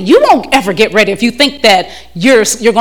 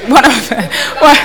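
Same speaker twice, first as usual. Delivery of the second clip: ordinary talk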